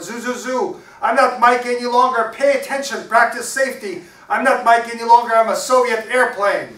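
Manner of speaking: high, exuberant full shout; Mikey hollering. Acted. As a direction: holler it like a child at play. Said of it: "and he hollered".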